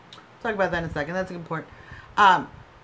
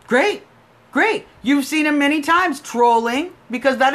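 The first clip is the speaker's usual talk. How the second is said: raised, louder, indignant; heated rant; 5 semitones higher, 4 dB louder than usual. Real, not acted. Scolding a fake supporter on the line, heated and loud. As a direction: hammer the caller with disbelief.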